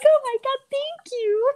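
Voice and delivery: high-pitched delighted squeal; thrilled. Retold or imitated. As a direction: gush like you got a gift. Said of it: "I was like".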